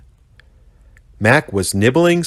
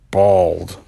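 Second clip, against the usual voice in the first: grinned. A narrator's reading. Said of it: "Bush Baby grinned".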